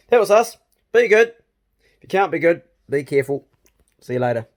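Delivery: big, loud cheerful goodbye; loud sign-off. Real, not acted. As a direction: shout the farewell to the camera. Loud vlog sign-off to camera.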